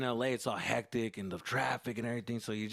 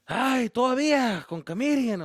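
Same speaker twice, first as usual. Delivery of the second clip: much higher, louder exasperated Mexican dad; 12 semitones higher, 11 dB louder than usual. Retold or imitated. Dad scoffing at the son's comedy dream, high and loud. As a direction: throw up your hands at the son.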